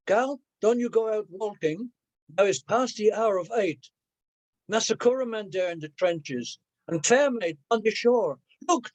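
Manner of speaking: Creole poem read with rising spooky drama; poem recital. Acted. Reads Creole poem aloud.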